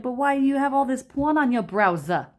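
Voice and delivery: high, loud hammed-up New York accent; mock city accent. Girlfriend confronting him about his browser, mock New York accent. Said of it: "I love the accent".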